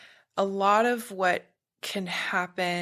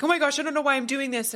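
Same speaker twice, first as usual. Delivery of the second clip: fast, pitched-up flustered voice; flustered client; 8 semitones higher, 3 dB louder, much faster than usual. Retold or imitated. Client surprised by crying, high and rushed. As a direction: rush it out, high and bewildered. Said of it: "then they're like".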